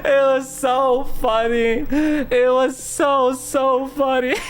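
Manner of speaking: high, slow words squeezed out through laughing; really cracking up while talking; helpless laughter. Real, not acted. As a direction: wheeze it out, stretching each word. Storyteller cracking up at own story.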